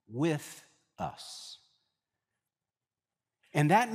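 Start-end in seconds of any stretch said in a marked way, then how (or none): 0.1-1.6 hushed. Real, not acted. Hushed ending of Emmanuel meaning.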